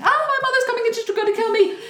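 high, loud hammed-up panic; mock panic. Queen running to her husband in hammed-up high panic. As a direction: squeal it like a frightened queen.

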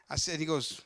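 normal talking voice

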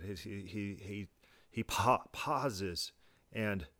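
slow and quiet, broken by long pauses; mild mocking halting delivery; halting impression. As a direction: drag it out with halting pauses like a mock Shatner.

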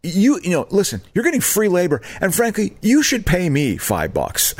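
high-pitched cocky demand; high mock lecture. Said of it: "I'm like".

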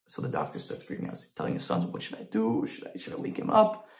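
hushed, worried whisper; frantic whisper; panicked whisper. Retold or imitated. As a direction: whisper it like a frightened doctor. Doctor panics after surgery.

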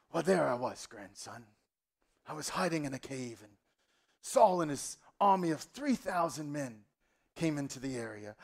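put-on old king spinning a tale for his grandson; measured, put-on granddad storyteller voice; storytelling grandfather. Grandfather telling his grandson about the cave, hammed-up storyteller. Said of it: "tell us the story".

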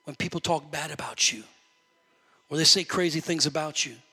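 ordinary talk

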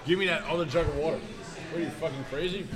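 low, strained from hot sauce; mouth burning from spicy food. Real, not acted. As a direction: gasp out through burning heat. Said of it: "My tongue is on fire".

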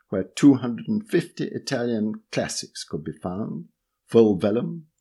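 plain reading voice

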